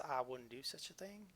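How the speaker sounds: unvoiced breathy whisper. Shown as a demonstration. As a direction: hush it, breathy and secretive. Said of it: "Am I trying to impersonate Doss?".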